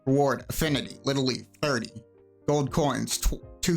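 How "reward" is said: normal talking voice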